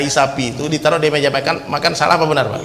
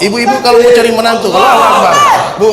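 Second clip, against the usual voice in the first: playful call-out; 4 semitones higher, 6 dB louder than usual. Acted. Joking appeal to mothers.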